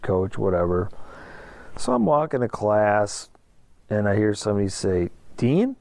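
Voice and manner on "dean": high rising call of a name. Retold or imitated. Passerby spotting him on the way to class, calling out high. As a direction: call out his name, questioning and pitched up. Someone calls him on campus.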